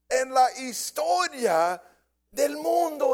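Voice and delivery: preacher at his climax; full-force preaching. Acted. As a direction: shout it as the peak of the sermon.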